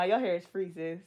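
usual speaking voice

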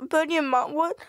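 ordinary talk

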